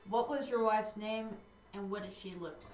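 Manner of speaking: soft, low inner voice; low, quiet telepathic question; mental voice. Pretend. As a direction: ask it softly, as if mind to mind. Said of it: "I did the mental thing".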